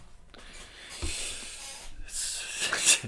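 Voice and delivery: high breathless burst of laughter; laughing. Real, not acted. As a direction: crack up mid-bit. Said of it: "I can't say it with a straight face".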